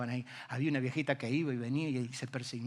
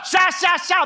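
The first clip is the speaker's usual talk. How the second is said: urgent; 12 dB louder, slower than usual. Retold or imitated. Sailor's quoted hurry.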